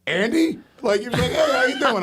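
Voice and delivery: chirpy greeting, pitched well up; imitating the cop. Andy imitating cop Chip greeting him at the crash. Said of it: "he goes".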